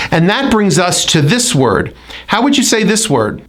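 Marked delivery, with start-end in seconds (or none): none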